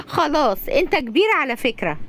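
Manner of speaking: high voice just after a giggle; amused, laughing. Acted. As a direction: humour the child, amused. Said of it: "صفاء بضحكة".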